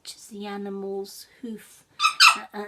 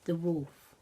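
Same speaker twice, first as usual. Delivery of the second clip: ordinary delivery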